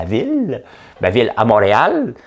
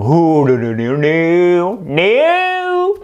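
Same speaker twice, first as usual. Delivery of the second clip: high, loud, slow drawn-out no; emphatic refusal; 9 semitones higher, 5 dB louder, much slower than usual. Retold or imitated. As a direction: recoil from the idea, stretching each no. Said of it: "j'ai fait comme".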